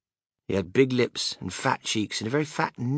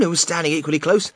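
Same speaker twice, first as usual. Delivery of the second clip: ordinary delivery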